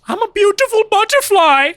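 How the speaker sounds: squeaky, proud butterfly voice; high character voice. Acted. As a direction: sing it out high and delighted. Shaquille centipede character speaks.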